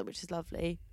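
soft, throwaway politeness; insincere. Real, not acted. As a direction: trail off softly, not quite convincing. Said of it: "that sounded so insincere".

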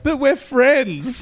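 high, slow, pleading; playing wounded innocence, hammed-up; mock hurt innocence. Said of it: "and I'm like".

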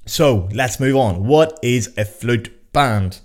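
slow, drawn-out mock-grand announcement. Podcaster finally announcing the topic, mock-grand and slow. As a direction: savour the question like a show host.

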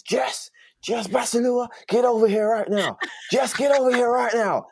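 high, loud Southern American teacher bellowing a name; a furious head of year with a strong Southern accent yelling across the playground; Southern accent shout. Retold or imitated. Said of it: "Big American guy with an accent".

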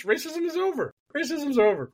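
high-pitched sarcastic declaration; mock proclamation. Host mimicking Elon and the Chief Justice, mocking. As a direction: announce it high, as a ridiculous claim.

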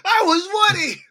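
high, loud echoed wail; mock wailing star. Co-host echoing the wounded actor, in a mock wail. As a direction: pretend to cry out in protest.

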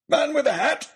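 sudden loud cry; excited hunter's shout. Acted. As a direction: yell it out as a sighting. Baronet spots a target and yells.

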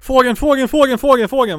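very high, very loud hysterical shrieking; imitated child's screaming. Toddler being put down in the crib, imitated hysterical scream. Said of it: "började han skrika, liksom".

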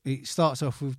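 ordinary delivery

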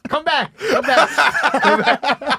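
high, frantic calling out; shouting. Pretend. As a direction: yell after someone leaving. Imagined commenters calling Louis.

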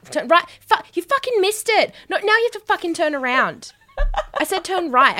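high exasperated sat nav scolding; angry GPS voice. Pretend. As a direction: snap at the driver like a fed-up GPS. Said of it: "great G P S voice".

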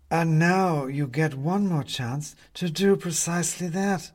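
slow, purring menace of a cat; prowling cat. A narrator's reading. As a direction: savour it slowly, like a cat playing. Cat answers.

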